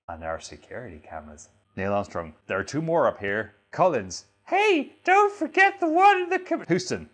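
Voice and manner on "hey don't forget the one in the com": very high, loud piping voice; squeaky call-out. Acted. Acted astronaut calls out.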